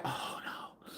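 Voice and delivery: breathy horrified whisper; whispered shocked reaction. Retold or imitated. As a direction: whisper it in dismay.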